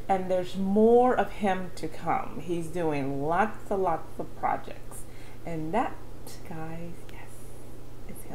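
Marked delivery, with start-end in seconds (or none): 5.4-8.4 soft excited whisper. Real, not acted. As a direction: breathe it out like a secret. Hushed reveal of the singer.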